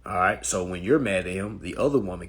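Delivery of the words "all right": normal talking voice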